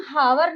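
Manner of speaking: हावर with an audible h at the start; mispronounced hour. Shown as a demonstration. Teacher showing what not to say, हावर with h sounded. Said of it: "नहीं बोलते हैं".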